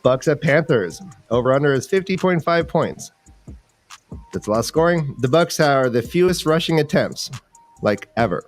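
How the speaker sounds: usual speaking voice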